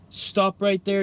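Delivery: granny bursting in yelling at the wolf. A narrator's reading. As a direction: shout it to stop him cold. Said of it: "yelled Granny".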